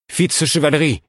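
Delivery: high, loud call from above. Acted. Queen calling down to Fitz on the scree. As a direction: call his name up the slope.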